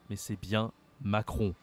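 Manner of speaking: ordinary talk, nothing marked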